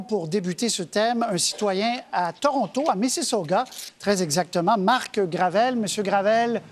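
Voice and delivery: ordinary talk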